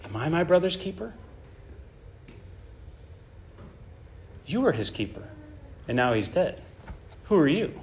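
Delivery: mild mocking sneer, soft and bitter; a hint of Cain sneering at God; bitter mockery. Cain sneering at God over his brother's death, quietly bitter. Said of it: "Do you hear that?".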